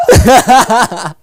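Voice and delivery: high, loud disbelieving echo; can't believe it; surprised. Real, not acted. Incredulous echo.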